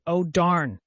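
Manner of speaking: ordinary delivery, nothing marked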